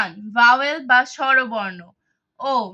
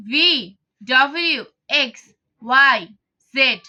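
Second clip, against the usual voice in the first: loud, spaced-out recital of letters; teacher reciting the alphabet aloud for pupils; letter drill; 5 dB louder, slower than usual. Shown as a demonstration. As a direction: call each letter out clearly to the class. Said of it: "teacher drills consonant letters".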